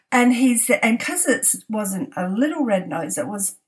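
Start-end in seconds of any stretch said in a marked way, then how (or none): none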